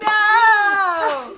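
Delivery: high, loud, wailing no; despairing shout. Retold or imitated. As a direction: wail the loss. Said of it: "immediately loses and goes".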